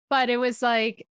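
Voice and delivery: ordinary delivery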